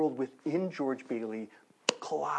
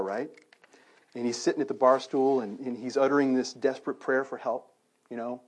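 ordinary talk

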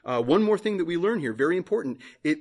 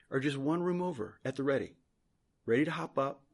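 ordinary talk, nothing marked

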